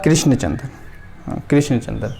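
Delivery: ordinary delivery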